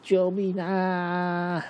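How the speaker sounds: 高而响的慌张大叫，最后慢慢拖长呼救; 像被烧到一样边叫边逃; 屁股着火的惊慌尖叫. Acted. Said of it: "迅猛龙就说". (着火的迅猛龙高声惊叫求救)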